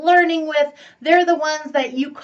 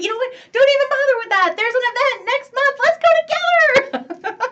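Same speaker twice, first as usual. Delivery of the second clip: higher, louder, breathless invite with a laugh; gushing invitation; 11 semitones higher, 4 dB louder than usual. Retold or imitated. Personal-growth fan pressing a friend to an event, gushing. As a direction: sell it like the best idea ever.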